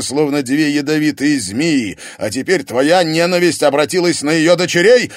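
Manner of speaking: high, loud accusing tirade; angry accusation. Acted. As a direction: pile on the accusations. Egor keeps accusing loudly.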